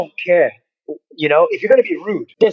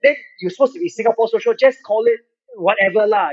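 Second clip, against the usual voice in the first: mock angry Singlish rant; imitating a rude local troll, high; 6 semitones higher than usual. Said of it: "they're like".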